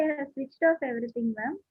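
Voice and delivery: usual speaking voice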